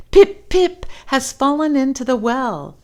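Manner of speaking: put-on high, loud breathless boy; gasping. Chang breathlessly telling his mother, high and loud. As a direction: push the last words out on no air. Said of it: "he gasped".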